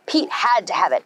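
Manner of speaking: usual speaking voice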